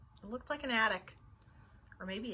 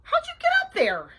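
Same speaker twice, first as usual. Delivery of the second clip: high, loud incredulous question; astonished; 11 dB louder than usual. A narrator's reading. Babysitter asking Bean in the attic, astonished. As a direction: call it up in amazement.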